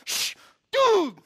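loud, high outburst after a shush; hammed-up aggrieved shout; mock indignant yell. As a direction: imitate an outraged drunk man protesting. Drunk man protests the prayer.

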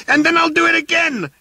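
very high shrill threat; furious yell. Acted. Arthur yelling after the fleeing workmen, shrill furious threat. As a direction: shriek it as you chase them. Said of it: "yelled Arthur".

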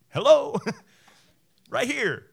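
loud, high, beaming "Hello" with a laugh; big mock greeting. Jesus offering himself, loud and high-pitched. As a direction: call it out grandly and cheerfully.

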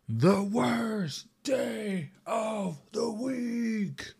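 high whiny fake sob; mock crying. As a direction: whimper it like a fake cry. Mock lament about Wednesday.

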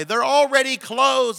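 high, drawn-out call down a hallway; imitated yell. Woman yelling at departing couple down the hallway, imitated yell. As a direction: call it out loud and slow, imitating her yell. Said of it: "she's yelling at us down the hallway".

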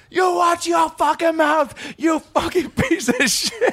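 high, slow furious Boston yell; Boston shouting. Retold or imitated. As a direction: scream it in a thick Boston accent. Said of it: "leans in a little bit over me as I'm driving and goes".

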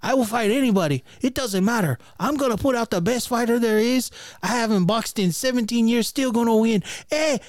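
high, loud mock boasting; boxer impression. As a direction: brag like a boxer who is very high. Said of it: "that's really what he sounded like".

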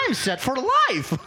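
high, slow mock-triumphant cry. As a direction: crow it high, drawing it out. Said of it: "[name] came with, like".

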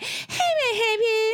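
high-pitched "may I help you" run into one word; syllables squashed together. Retold or imitated. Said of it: "sounds like that [name] thing".

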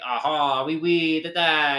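exaggerated stock French accent; put-on French accent. Showing a friend how people fake it, in a hammed-up French accent. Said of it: "you can put on a French accent".

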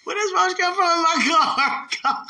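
high, loud, slow laughing question; laughing shout. Real, not acted. Joking with a friend about a roach in the car, laughing loud.

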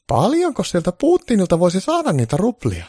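high, loud granny asking about money; granny's greedy high question. Acted. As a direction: play the granny smelling money.

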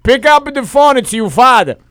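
loud Brooklyn father voice; ringtone voice. Retold or imitated. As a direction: bark it like an old-country dad. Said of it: "One of them says".

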